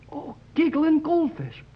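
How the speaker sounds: high, slow, amazed cry; light up with a sudden idea; delighted exclamation. Acted. Boy realising the fog is magnetized, amazed.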